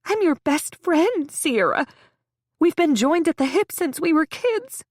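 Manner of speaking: high, loud wounded insistence; plead, offended; hurt best friend. A narrator's reading. Hurt best friend reminding the patient, high and wounded. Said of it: "looks hurt, offended".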